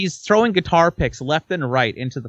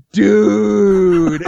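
stunned; 3 semitones higher, 7 dB louder than usual. Retold or imitated. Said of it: "He's like".

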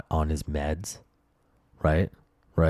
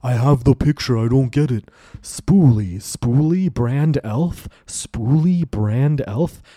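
loud, higher exasperated rant; worked-up shopper at wits' end; upset; 5 semitones higher, 6 dB louder, slower than usual. Retold or imitated. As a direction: repeat it in loud frustration. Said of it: "I was so upset".

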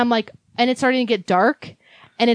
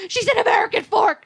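urgent report; 10 semitones higher than usual. Retold or imitated. Telling the cop urgently.

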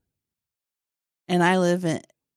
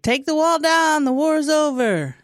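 high, raised crying-out voice; crowd's proclamation; 10 semitones higher, 4 dB louder than usual. Acted. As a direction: call it out to the masses. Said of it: "the people said".

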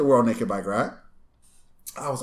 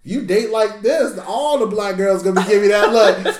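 sassy retort; 7 semitones higher, 5 dB louder than usual. Retold or imitated. Voicing sassy reply to date.

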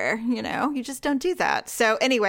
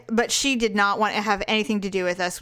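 usual speaking voice

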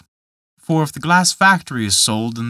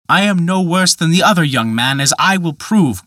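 raised, louder, defiant retort; defiant cry; 5 semitones higher, 4 dB louder than usual. A narrator's reading. Peter defies the glass man, crying out louder. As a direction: hurl it back at him. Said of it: "cried Peter".

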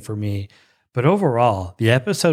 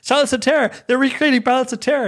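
high-pitched, fast fan excitement; ecstatic; 12 semitones higher, faster than usual. Retold or imitated. Fan seeing Balance of Terror recreated, ecstatic. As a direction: geek out way up high, words tumbling. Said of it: "I was so ecstatic".

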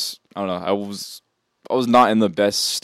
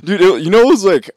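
cracking, strained voice; 11 semitones higher, 10 dB louder than usual. Real, not acted. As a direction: voice cracks up high. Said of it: "my voice just cracked so bad".

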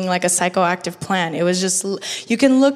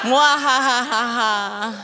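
slow evil cackle; mad scientist laugh; slower than usual. Acted. Joke song intro, villain laugh.

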